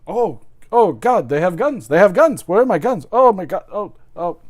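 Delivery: loud, high mock panic, British accent; Eddie Izzard. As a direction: wail it like a panicking British comic. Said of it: "my take on the British accent".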